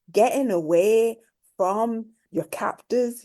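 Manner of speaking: usual speaking voice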